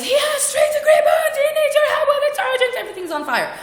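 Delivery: very high, loud hysterical message; panicked reading. Retold or imitated. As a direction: read it as a frantic hammed-up emergency. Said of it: "but I read it".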